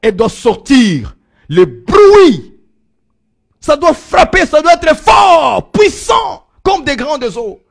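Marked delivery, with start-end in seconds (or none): 1.5-7.6 loud, high bursts of preaching; shouted sermon climax in short, punched phrases; full-force preaching. Acted. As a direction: hammer each phrase out to the congregation.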